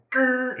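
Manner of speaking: short, loud, high-pitched buzz; imitated pager noise. Boss keys the pager before announcing, imitated buzz. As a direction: mimic the squawk of a pager keying up.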